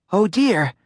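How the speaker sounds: alarmed. A narrator's reading. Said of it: "cried Miss Plum".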